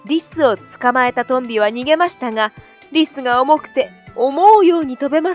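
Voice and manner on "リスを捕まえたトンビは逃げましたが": ordinary delivery, nothing marked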